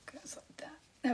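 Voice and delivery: quick hushed self-talk; whispering. Real, not acted. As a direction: mutter it under your breath. Whispering to herself while painting.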